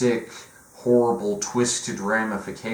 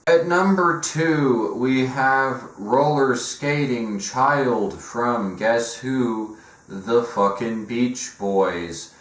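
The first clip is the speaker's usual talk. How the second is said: countdown announcer; 4 semitones higher, 3 dB louder, slower than usual. Acted.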